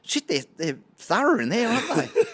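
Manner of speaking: high, lightly voiced woman, half laughing; mock indignant woman. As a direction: mimic her flustered complaint. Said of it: "she goes".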